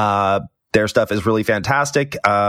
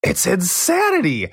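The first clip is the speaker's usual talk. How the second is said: mock amazement; 8 semitones higher than usual. Mock amazement at outside comment.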